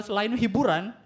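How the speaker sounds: ordinary talk, nothing marked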